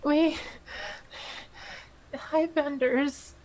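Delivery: tearful. Acted. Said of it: "just starts sobbing uncontrollably".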